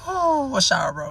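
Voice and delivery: high, loud disbelief; can't believe he showered; incredulous. Real, not acted. Incredulous at Brick's towel.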